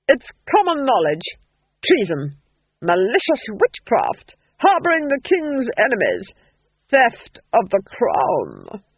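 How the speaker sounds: loud, measured accusations; imperious Duchess. A narrator's reading. Duchess accuses Nanny in the dungeon, imperiously. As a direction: read out charges with relish for each.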